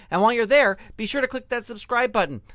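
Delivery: ordinary talk, nothing marked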